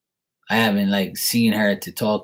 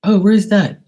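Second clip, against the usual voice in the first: high, loud clueless question; puzzled saleslady; 12 semitones higher, 7 dB louder than usual. Acted. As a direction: imitate a baffled clerk, pitched way up and louder.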